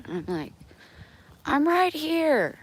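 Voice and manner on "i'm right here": high, slow call back. Retold or imitated. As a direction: answer from outside. Answering dad's call.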